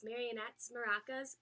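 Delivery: ordinary delivery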